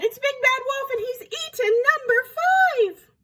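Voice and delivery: very high, shouted accusation; enraged cry. A narrator's reading. Missus Duck accuses the wolf, crying out high. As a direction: shriek it in hammed-up duck outrage. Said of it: "she cried".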